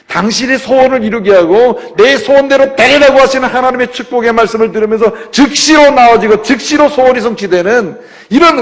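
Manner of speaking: high, loud preaching climax; fervent preaching. Acted. Pastor exhorting the congregation on great faith, fervent preaching. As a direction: preach it with rising fire.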